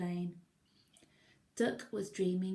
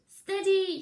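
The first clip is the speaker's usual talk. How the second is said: high, much louder call; Duck's shout; 13 semitones higher, 12 dB louder than usual. Acted. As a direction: shout it out sharp. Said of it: "shouted Duck".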